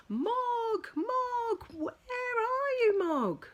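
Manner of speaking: high, loud calling across the garden. Acted. Family calling for Mog in the dark garden, loud. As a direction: call the cat from far off. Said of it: "They shouted".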